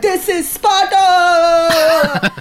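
long drawn-out, high war cry; mock battle scream. Salmon breaking out of their pens, screaming a battle cry. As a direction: scream it slowly like a rebel leading a breakout. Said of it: "just screaming like".

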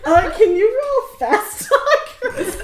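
loud, high, drawn out through laughter; laughing. Real, not acted. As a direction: squeeze the question out while cracking up. Joke breaks up the scene.

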